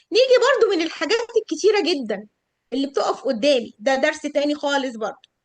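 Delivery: usual speaking voice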